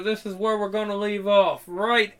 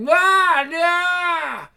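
very high, drawn-out wail; hammed-up high shriek; imitated scream; 11 semitones higher, 4 dB louder than usual. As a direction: mimic her screaming, loud at first. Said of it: "she's like".

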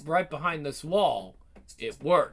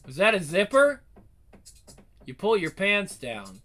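usual speaking voice

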